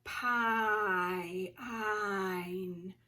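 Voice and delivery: "Pie, ein" as pine with a long eye repeated; slow sounding-out. Shown as a demonstration. Teacher checking pine for its vowel, slowly sounded out. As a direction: stretch pine across its vowel. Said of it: "Let's say 'em slow".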